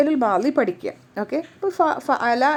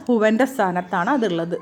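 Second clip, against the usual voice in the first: ordinary talk, nothing marked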